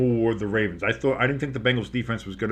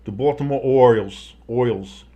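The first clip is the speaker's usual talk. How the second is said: mock Baltimore accent on the team name; lightly put-on Baltimore local; 5 dB louder than usual. Shown as a demonstration. Announcer trying the team name in a Baltimore accent. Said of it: "say Orioles in Baltimoreese".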